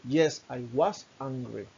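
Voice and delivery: slow, clear answer sentence; slow teacher demonstration; slow model answer. Shown as a demonstration.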